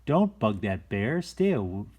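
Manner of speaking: ordinary delivery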